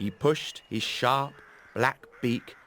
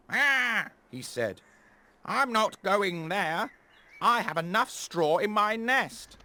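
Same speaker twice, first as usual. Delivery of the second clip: wary crow; 9 semitones higher than usual. Acted. Third crow backs off.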